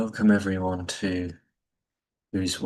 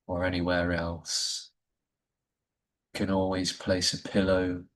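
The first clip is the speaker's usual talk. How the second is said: guided meditation. Acted. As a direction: ease listeners into stillness with gentle pacing. Leads a Yoga Nidra session.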